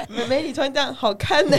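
laughing through a mock compliment; laughing retold compliment. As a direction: imitate him while cracking up. Retelling a man's compliment, laughing.

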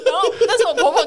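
high, laughing, slowed words; can barely get it out for laughing; telling friends how she cried, laughing hard; laughing through the story. Real, not acted. Laughing retelling her crying.

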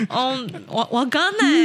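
put-on playful Japanese-accented reply. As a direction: act confused in a Japanese accent. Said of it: "所以现在还要带日本腔来". (日本腔)

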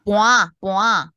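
盘啊 drawn out slowly and loud; Taiwanese word. Shown as a demonstration. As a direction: repeat 盘啊 slow, stressed. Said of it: "北部用语叫做".

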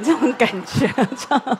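loud, laughing through the words. Real, not acted. Pastor amused at being caught in the wild, laughing. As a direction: chuckle at the joke on yourself.

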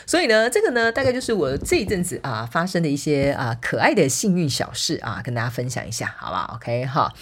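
normal talking voice

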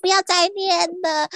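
high, drawn-up voice acting out a silent plea; hammed-up inner protest at being nagged. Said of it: "我心想说".

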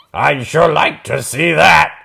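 high, loud greedy troll. Acted. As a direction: relish the thought of food. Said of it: "He twirled his chin hair".

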